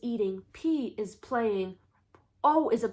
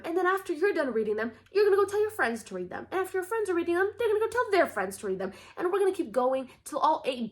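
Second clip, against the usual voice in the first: excited; 8 semitones higher, faster than usual. Real, not acted. Building excited chain.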